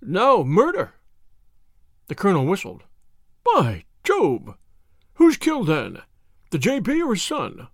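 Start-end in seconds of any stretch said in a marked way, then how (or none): 0.0-1.0 agitated. Acted. Butler announces murder. 3.4-7.7 shocked. Acted. Colonel shocked by news.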